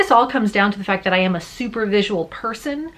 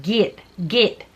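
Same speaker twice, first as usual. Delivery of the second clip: light Southern accent. Shown as a demonstration. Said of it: "if I was doing a Southern accent".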